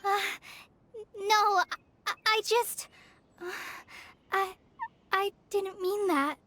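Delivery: halting, shaken apology; faltering and rattled; flustered stammer. Acted. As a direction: stumble over the words with long hesitations. Stammers apology after panicked outburst.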